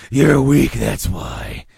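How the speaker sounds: loud gruff outburst, dropping at the end; Harvey's gruff alternate voice. Acted. Two-Face turning on Bruce, gruff and accusing. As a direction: burst out gruffly as the harsher personality. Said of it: "the kind of gruff alternate voice".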